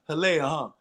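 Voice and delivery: Hialeah said ha-LAY-uh, with a laugh; teasing mispronunciation. Pretend. Guest planning to rib Ben Affleck, Hialeah as ha-LAY-uh. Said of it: "first thing I'm gonna say to him".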